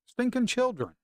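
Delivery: high, disgusted exclamation; exasperated outburst. Real, not acted. Fed-up trader ranting at world leaders, high exasperated outburst. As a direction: spit it out in high-pitched disgust.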